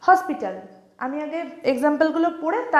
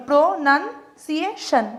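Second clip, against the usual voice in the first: "Pronunciation" slowly in four parts, "সিয়ে" joined; fewer syllable breaks; 3 semitones higher, slower than usual. Shown as a demonstration. Teacher showing how many people divide it, fewer syllable breaks. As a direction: break "pronunciation" up but keep "সিয়ে" as one. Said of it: "এটাও অনেকে একবারে করে".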